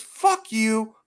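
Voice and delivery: high, loud mock shouting of fuck; hammed-up furious marshal yelling; imitating a marshal's high shouted curses; angry yelling. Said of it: "he goes".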